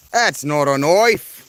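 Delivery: lightly voiced Aussie bravado; Crocodile Dundee quote. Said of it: "I was trying to do the, uh, Crocodile Dundee".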